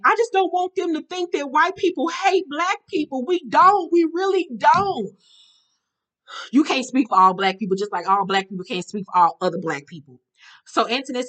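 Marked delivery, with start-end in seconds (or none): 0.0-5.1 high, pleading mock whine; Caitlyn. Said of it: "So Caitlyn says to Antoinette".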